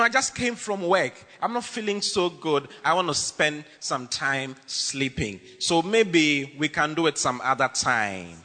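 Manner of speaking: slow, drawn-out tired excuse; a lazy, slowed-down brush-off; mock tired excuse. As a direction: drag it like you're worn out from work. Acting a tired member's excuse.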